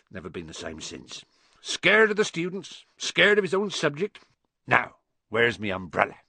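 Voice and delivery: proud, colloquial big-man voice with lively swings; Hagrid, rustic and chatty. Acted. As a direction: chat like a rough, kindly giant.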